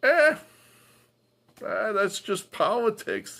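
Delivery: mock brush-off; dismissive shrug. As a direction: sneer at the indifferent crowd. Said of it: "are just like".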